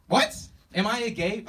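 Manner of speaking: loud, high-pitched mock disbelief; taken aback. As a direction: throw the question back high and loud, incredulous. Teacher acts shock at student's question.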